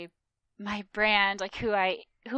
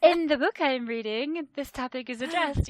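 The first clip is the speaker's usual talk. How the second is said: know-it-all bookworm; 5 semitones higher, 7 dB louder than usual. Retold or imitated. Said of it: "that person who's like".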